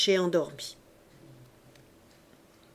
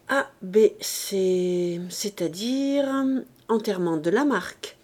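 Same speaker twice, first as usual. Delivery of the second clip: ordinary delivery, nothing marked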